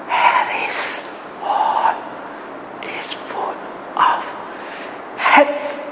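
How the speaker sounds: soft breathy whisper. Shown as a demonstration. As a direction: whisper it to the class. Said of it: "Whisper it".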